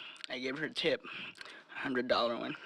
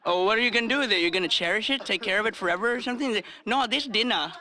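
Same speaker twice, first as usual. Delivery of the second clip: loud mock dialogue; 6 semitones higher, 6 dB louder than usual. Acted exchange with lady.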